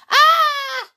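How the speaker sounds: loud high shriek; scream. Acted. Matron screaming at the pigeon, loud and high. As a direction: let out a startled scream. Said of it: "screamed Matron".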